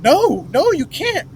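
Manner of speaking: high, sharp no no you can't; refusing clerk. Retold or imitated. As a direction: shut him down like a nervous clerk. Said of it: "she's like".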